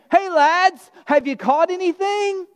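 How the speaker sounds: a relaxed friendly hail from the shore; lightly voiced casual Jesus calling out to the fishermen. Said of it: "it's really casual".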